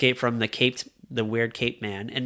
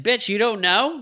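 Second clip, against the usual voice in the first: mock incredulous; 7 semitones higher than usual. Woman voiced, mock incredulous.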